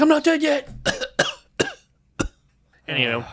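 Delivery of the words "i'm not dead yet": high, squeaky dying fish. Retold or imitated. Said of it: "He's like".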